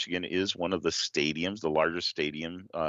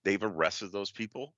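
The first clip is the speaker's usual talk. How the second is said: ordinary talk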